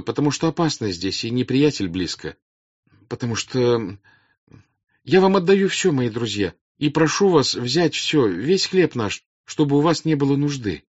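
high, loud appeal to a crowd; earnest princess. Acted. Princess offers bread and homes to peasants, earnest appeal.

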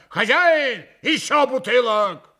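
loud, high order across the room; громко зовёт хозяина. A narrator's reading. Mitya calls the landlord for bottles. (громкий зычный заказ)

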